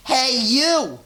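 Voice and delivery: high, loud challenge from a tiny bug; grouchy ladybug. A narrator's reading. Ladybug squaring up to the rhinoceros, high and loud. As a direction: squeak it out like a picking-a-fight bug. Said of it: "said the grouchy ladybug".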